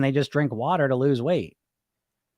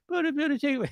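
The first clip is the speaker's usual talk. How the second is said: whimpering bully; 12 semitones higher, 5 dB quieter than usual. Acted.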